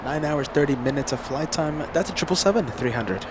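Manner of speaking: usual speaking voice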